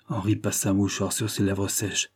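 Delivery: ordinary delivery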